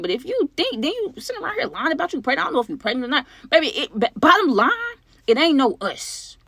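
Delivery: high-pitched, worked-up rant; heated telling-off. Acted. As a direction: pitch climbs as the argument heats up. Telling off Martha in the story.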